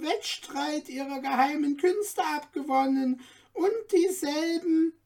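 high, eager little dwarf guide; courteous little dwarf. A narrator's reading. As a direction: play the tiny helpful dwarf showing off treasures. Dwarf explains the shoes.

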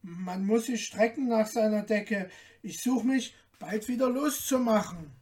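little man plotting inwardly; tailor thinking to himself. A narrator's reading. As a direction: muse privately in the small tailor voice. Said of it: "dachte in seinem Sinn".

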